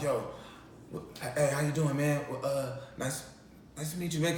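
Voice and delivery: quiet, starstruck fumbling hello; soft awkward fanboy greeting; nervous mumbled greeting. Retold or imitated. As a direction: mumble it shyly to a star. Nervous meeting with celebrity.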